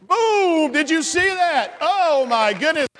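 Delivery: high, amazed celebration; playful sports-hype astonishment; excited cheer. Real, not acted. Celebrating the shot again.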